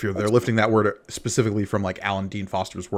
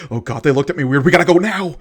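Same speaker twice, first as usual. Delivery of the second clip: higher, building to a loud cry; mock panicked shout; 6 semitones higher, 7 dB louder, faster than usual. Imitating Leia freaking out at a look, mock panicked shout. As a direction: send up a freak-out, louder towards the end. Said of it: "is shouting it".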